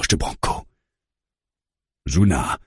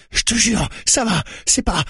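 high, urgent plea; pleading, breaking off mid-sentence; panicked insistence. A narrator's reading. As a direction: swear it in a high, frantic voice. Wounded Branco insists he's fine.

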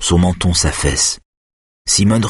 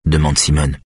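plain reading voice

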